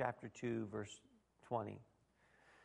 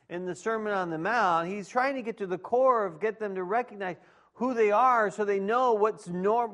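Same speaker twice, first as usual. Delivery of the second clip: higher, louder sermon voice, driving the point home; preaching at full force; 7 semitones higher, 8 dB louder than usual. Acted. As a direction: preach it out to the room, raised and ringing. Pastor preaching to congregation.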